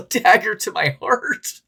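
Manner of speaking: a hammed-up dagger to the heart; mock heartbroken boyfriend. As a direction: play the wounded lover for laughs. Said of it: "And then you're like".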